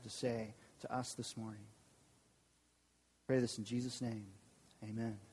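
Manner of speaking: soft, hushed prayer voice. Acted. Pastor praying for the congregation's open hearts, soft and hushed. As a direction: pray quietly over the listeners.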